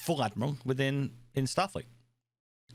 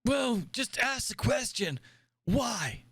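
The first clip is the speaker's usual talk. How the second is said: put-on high, slow old admiral; cranky old commander lecturing; 8 semitones higher, slower than usual. Plays old admiral character.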